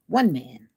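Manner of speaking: one hit with a falling tune; stress on one. Real, not acted. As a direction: lean hard on the word one. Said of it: "I need one man".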